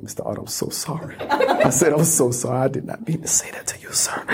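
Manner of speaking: soft, hushed apology to an elder; whispered apology. Retold or imitated. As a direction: whisper sorry like you mean it. Whispered apology to Mister Hart.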